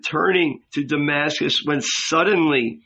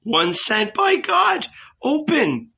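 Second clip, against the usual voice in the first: put-on much higher, slow and cracked, like a strained old man; 13 semitones higher than usual. A narrator's reading. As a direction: crack the voice high and thin. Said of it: "A high, cracked voice replied".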